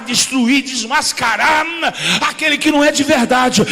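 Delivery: loud, rhythmic sermon shout; full-force preaching. Acted. Pastor preaching loudly on being a true man of God. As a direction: preach it hard to the congregation.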